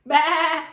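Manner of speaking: loud high baa; sheep bleat. Acted. Sheep answers Duck with a loud bleat. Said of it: "we have to use the voices".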